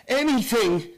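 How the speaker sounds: sudden triumphant shout; wild, manic cry of triumph. Acted. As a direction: burst out with it, possessed. Obsessed man's rising claim.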